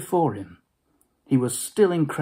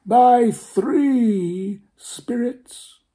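very slow, higher, louder doom-laden spectre; ominous ghost; 10 semitones higher, 6 dB louder, much slower than usual. Acted. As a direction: stretch out the prophecy. Ghost's prophecy continued.

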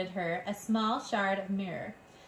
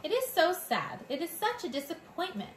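high, sighing dramatic lament; theatrical sadness; 7 semitones higher than usual. A narrator's reading. French mother mouse sighing over her lost babies, hammed-up sadness. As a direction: pout it out like a tragic diva. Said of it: "Disappointment was one of her favorite words".

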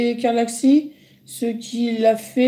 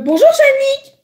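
put-on much higher, louder excited kid voice; excited fan; 7 dB louder than usual. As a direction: squeal it like a starstruck young fan. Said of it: "Il me fait". (enfant fan surexcité, voix aiguë)

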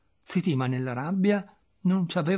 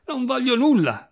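high, vehement refusal; high-pitched angry yell; furious shout; 10 semitones higher, 4 dB louder than usual. A narrator's reading. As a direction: cry out the refusal from inside. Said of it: "gridò Zitima".

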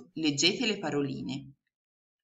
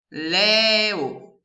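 louder "Leo" pulled apart as LE-O; split into syllables; 4 semitones higher, 7 dB louder than usual. Shown as a demonstration. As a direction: sound out "Leo" in two beats. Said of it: "La prima parolina è". ("Leo" diviso in sillabe)

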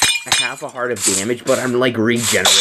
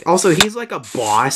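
ordinary delivery

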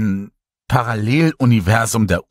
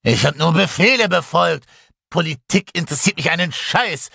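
angry defense; 9 semitones higher than usual. A narrator's reading. Boris defends himself angrily.

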